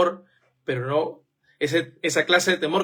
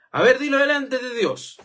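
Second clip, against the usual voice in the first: demanding oath; 7 semitones higher than usual. Retold or imitated. Preacher mimics someone demanding an oath.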